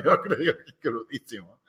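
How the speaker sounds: normal talking voice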